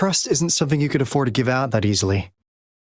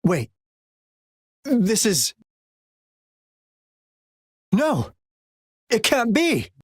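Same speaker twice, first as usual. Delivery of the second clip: higher, sharp cry of disbelief; shocked disbelief; 5 semitones higher, slower than usual. Acted. Agent finding the beacon gone, shocked cry. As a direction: recoil at something impossible.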